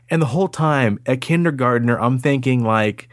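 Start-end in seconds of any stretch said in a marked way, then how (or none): none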